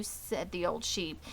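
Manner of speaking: ordinary delivery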